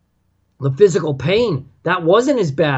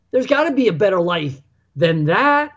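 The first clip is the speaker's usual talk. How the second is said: ordinary talk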